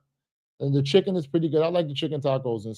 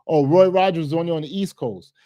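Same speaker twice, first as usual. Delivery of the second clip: normal talking voice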